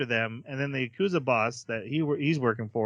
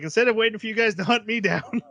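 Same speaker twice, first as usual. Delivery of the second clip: higher and brash, a laugh in the middle; cocky Mugen; 10 semitones higher, 3 dB louder than usual. Retold or imitated. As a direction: barge in swaggering, grinning. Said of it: "He's like".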